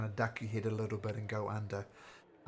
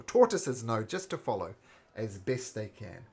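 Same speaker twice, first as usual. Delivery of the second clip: ordinary delivery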